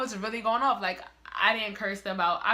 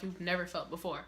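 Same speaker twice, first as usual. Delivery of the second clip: ordinary delivery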